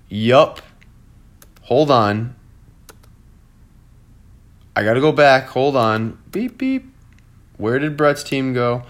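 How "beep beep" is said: mock beeping. Mock rewind noise while searching.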